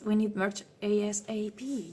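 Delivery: normal talking voice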